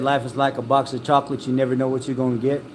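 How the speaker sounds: fast, run-together movie line; rushed quote. Shown as a demonstration. Showing how people rush the chocolates line, said fast. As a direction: rattle it off too quickly like people always do. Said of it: "people always say it too damn fast".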